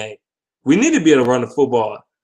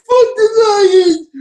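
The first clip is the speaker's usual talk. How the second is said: very high, louder, slow squeal with a mock sob; mocking clown; 7 dB louder, slower than usual. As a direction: wail it like a crying clown. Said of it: "You sound like Judah Tribe".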